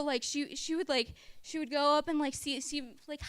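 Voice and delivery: usual speaking voice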